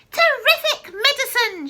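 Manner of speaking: very high, loud delighted shriek; gleeful cry. Acted. Grandma crying out to George in delight. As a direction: crone thrilled by the medicine. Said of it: "she cried".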